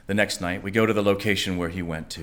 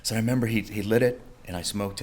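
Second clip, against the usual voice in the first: normal talking voice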